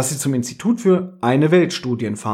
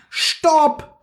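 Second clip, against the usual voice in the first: high-pitched yell at ordinary volume; acted yell. A narrator's reading. Pinky blocks Sir Enoch's car, high acted yell. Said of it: "brüllte sie".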